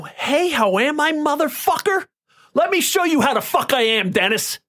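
high-pitched furious retort at the man who abused him; retold enraged snarl. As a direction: throw his greeting back at him in rage. Retelling confronting his abuser, enraged.

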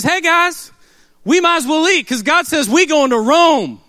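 high, loud rallying call; rousing Paul. Retold or imitated. Paul calling to the crew in the storm. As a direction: fire up the shipmates.

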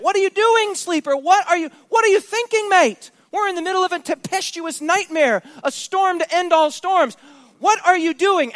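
high, loud, incredulous demand; a captain bellowing over a storm; captain's shout. Acted. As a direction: yell at the sleeper to wake. Captain waking Jonah in storm.

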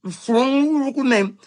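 a lightly voiced mock premier. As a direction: ape a politician's careful advice. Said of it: "Palaszczuk now is advising".

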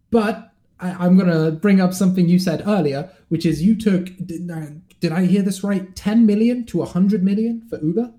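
bursting with excitement to ask; excited. Real, not acted. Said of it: "I can barely contain my excitement".

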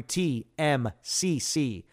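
letter by letter, slowly; spelling out slowly. Shown as a demonstration. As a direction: spell each letter out slow. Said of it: "I'll spell that out for you".

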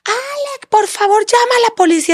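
high, drawn-out cry for help; retold desperate scream. Mother on the floor calling her son, retold desperate scream. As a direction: imitate calling out desperately, pitch way up. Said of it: "le empecé a gritar".

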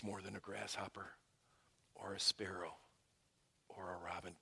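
fading into a whisper; hushed. Real, not acted. Pastor softly ending the list to the congregation, whispered. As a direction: let it fall away to a whisper. Sermon drops to hush.

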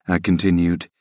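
ordinary delivery

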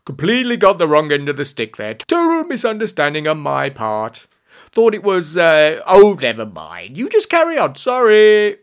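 loud, chirpy backing down; cheery apology. A narrator's reading. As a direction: bumble cheerfully through an apology. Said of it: "said quite cheerily".

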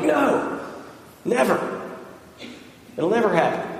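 high-pitched protest as Peter; retold disciple's refusal, pitch jumping at the start; insistent denial. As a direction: imitate an adamant refusal. Acting Peter refusing the prediction.

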